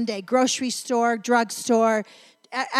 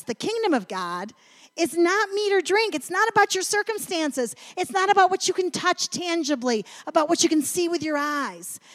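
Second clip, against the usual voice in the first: higher, ringing preacher's voice, proclaiming; fervent preaching; 5 semitones higher, 3 dB louder than usual. Acted. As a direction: lift it up and proclaim it to the congregation. Preaching the Romans verse.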